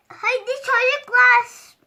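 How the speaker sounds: slow high loud call; calling out loudly. Acted. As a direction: call the kids drawn out. Mother calling kids to car.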